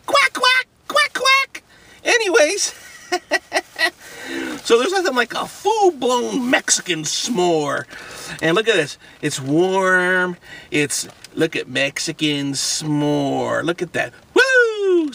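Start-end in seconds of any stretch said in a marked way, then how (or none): 0.1-1.6 high, loud mock duck quacking; duck quacks. Vlogger quacking like a duck at his food. 14.3-15.1 high, loud woo; excited whoop. Real, not acted. Vlogger whooping before the first bite.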